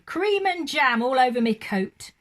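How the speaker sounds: loud, grumbling cockney cabbie; bitter. A narrator's reading. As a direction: complain bitterly about the mess. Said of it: "he said bitterly".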